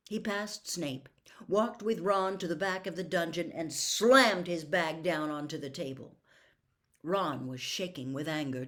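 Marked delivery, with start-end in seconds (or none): none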